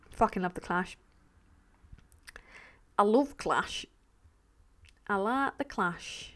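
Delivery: Darren's voice. Retold or imitated. Gushing about [name] in a friend's lightly mimicked voice. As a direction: slip into a mate's voice, lightly. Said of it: "Why do I keep doing Darren's voice?".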